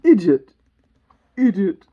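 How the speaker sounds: loud, impatient snap; impatient Queen. Acted. Queen tossing her head, loud and impatient. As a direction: throw the insult out loudly. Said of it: "tossing her head impatiently".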